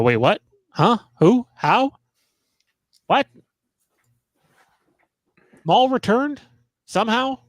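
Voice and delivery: high-pitched, baffled questions rising at the end; mock bewilderment. Acting out a baffled viewer's reaction.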